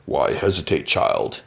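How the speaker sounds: low, hushed, sinister coaxing; lightly voiced sinister vampire king; tempting vampire. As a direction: lure her in, dropping to a breathy hush.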